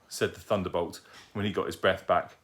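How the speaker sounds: usual speaking voice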